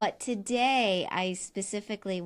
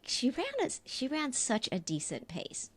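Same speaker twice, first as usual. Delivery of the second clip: a hushed whisper to herself; whispered aside; 3 semitones higher, 7 dB quieter than usual. Retold or imitated. Telling Rob what she thought, whispering. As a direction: breathe it out as a private aside. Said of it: "I was like".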